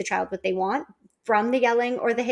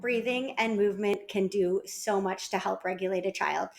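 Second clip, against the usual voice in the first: usual speaking voice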